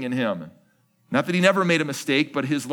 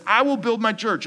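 proclaiming Jesus' words; 9 semitones higher, 4 dB louder than usual. Acted. Quoting Jesus emphatically.

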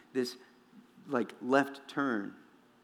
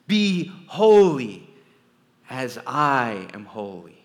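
slow, much louder "Be holy" then easing; divine command; 9 dB louder, slower than usual. Acted. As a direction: proclaim it slowly like God commanding. God demanding holiness quoted.

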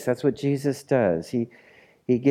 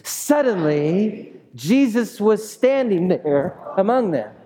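slow, high, dramatic build on suddenly; dramatic reading; 9 semitones higher, 3 dB louder, slower than usual. Shown as a demonstration. Pastor showing the congregation how to read dramatically. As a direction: read it like the story is unfolding now. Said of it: "Let's say it dramatically now".

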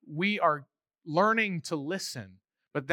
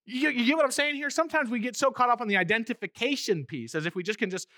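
normal talking voice

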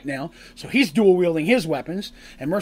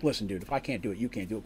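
low, much softer, dry and matter-of-fact; flat deadpan; 8 semitones lower, 12 dB quieter, faster than usual. Retold or imitated. Darsh to Cole after his failed try, low and dry. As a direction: mutter it flatly under the breath. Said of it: "Darsh is like".

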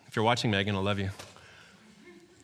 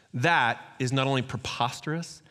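ordinary talk

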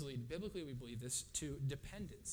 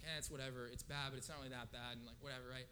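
low, softer offhand shrug; blasé, half-muted brush-off; mock dismissive; 4 semitones lower, 6 dB quieter, faster than usual. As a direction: wave it off as no big deal. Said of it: "sin is not a big deal at all".

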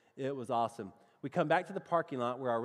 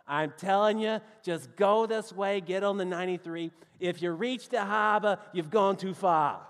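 loud, insistent mock Boston accent; imitating a Boston local, higher and louder; 4 semitones higher, 5 dB louder than usual. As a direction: drop the R's like an insistent Bostonian. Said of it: "here were the directions they gave me".

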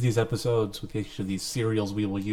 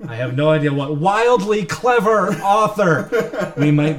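laughing; 6 semitones higher, 7 dB louder than usual. Real, not acted. Laughing, teasing self-praise.

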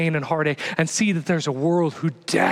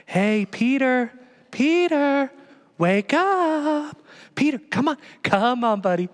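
higher, lightly coaxing "Peter, come on"; soft gentle Jesus; 6 semitones higher, slower than usual. Retold or imitated. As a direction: gently wake a sleeping friend. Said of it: "Very soft and gentle".

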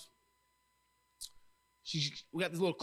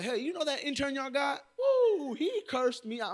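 high, loud mock gossip; excited tattling; 10 semitones higher, 6 dB louder than usual. Onlooker tells others about the intern, excited tattling. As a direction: exclaim it in high-pitched outrage, with a big whoop.

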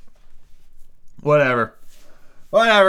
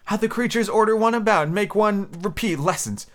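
a hint of a put-upon pupil, higher; lightly voiced Alice; 8 semitones higher than usual. Alice thinking about the creatures, lightly voiced grumble.

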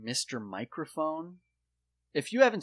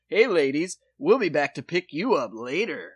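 loud, swooping pickup call; dorky guy; 3 semitones higher, 7 dB louder than usual. Retold or imitated. Dorky guy calling to ladies from a car, loud cheesy come-on. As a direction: holler it like a cheesy ad dork. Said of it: "Three of the dorkiest guys".

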